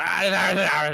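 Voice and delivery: loud frustrated yelling; loud angry dwarf leader yell; angry shouting. Acted. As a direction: shout at the crew like an angry boss. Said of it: "he's like".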